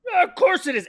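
high, loud indignant reply; indignant character voice. Acted. Albert confirming it is his brother, loud high indignation. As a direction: pitch it way up, put out.

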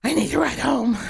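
high, nervous plea for a lift; anxious plea. Retold or imitated. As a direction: ask for a ride home, a little scared. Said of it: "I go".

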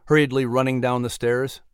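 ordinary delivery

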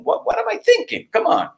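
mock self-scolding. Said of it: "thinking like".

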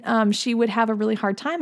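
usual speaking voice

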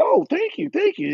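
very high, gushing thank you; overwhelmed thanks. Retold or imitated. Player thanks the owner, very high gushing gratitude. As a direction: gush with disbelief.

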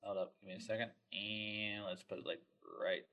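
very soft, off mic; faint and distant under hiss. Real, not acted. Mic still broken.

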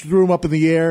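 ordinary talk

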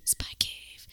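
hushed mock-mysterious whisper; spooky whisper. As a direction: whisper it like a spy secret. Repeats the cave name whispered.

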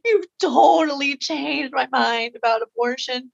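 lightly imitating a tearful confession; a touch of tearfulness, retold; retold tearful sobbing. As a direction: quote her as she confesses through tears. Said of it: "started streaming tears and sobbing".

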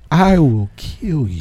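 mock threat. Dad threatening.

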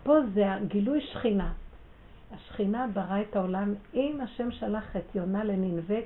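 ordinary talk

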